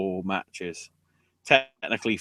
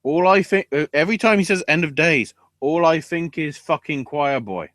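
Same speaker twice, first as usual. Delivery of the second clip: higher, louder, worked-up rant; excited; 6 semitones higher, 6 dB louder than usual. Real, not acted. Ranting to the co-host about the catchphrase, excited. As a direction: burst out with it.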